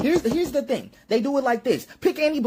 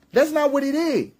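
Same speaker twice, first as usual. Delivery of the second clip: usual speaking voice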